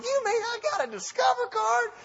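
very high falsetto amazement; hammed-up, high-pitched astonishment; freaking out. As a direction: squeal it in comic disbelief. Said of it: "I'm just freaking out".

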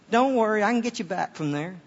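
playful Southern navigator, lifted in pitch; Southern accent. Pretend. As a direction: start high and reassure in a Southern accent. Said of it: "And then it's really Southern".

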